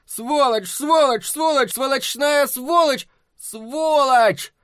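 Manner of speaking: high-pitched repeated yelling; frantic high shouted abuse; angry shouting. A narrator's reading. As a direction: hurl the insult over and over, drawn out. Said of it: "крикнул он".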